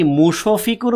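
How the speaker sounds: মুশফিকুর with an extra o, mu-sho-fikur; wrong pronunciation. Shown as a demonstration. Teacher questions students on wrong form, mu-sho-fikur. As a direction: slowly open the sh into sho in মুশফিকুর. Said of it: "আমরা কি মুশফিকুর বলি না".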